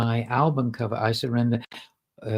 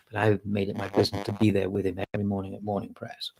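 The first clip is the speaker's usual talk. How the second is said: ordinary talk, nothing marked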